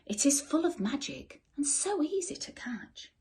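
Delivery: secretive whisper. A narrator's reading. Jack whispers the lake secret to the goblins.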